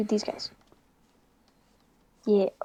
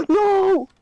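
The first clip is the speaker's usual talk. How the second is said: gasping loud no; shocked cry; 10 semitones higher, 8 dB louder than usual. Real, not acted. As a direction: gasp then cry out. Gasps as game goes wrong.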